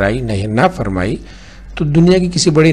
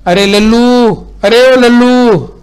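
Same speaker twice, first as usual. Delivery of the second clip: high, loud, drawn-out call; 11 semitones higher, 6 dB louder, slower than usual. A narrator's reading. Wife calling the servant loudly from another room. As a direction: call the servant from across the house, high and long.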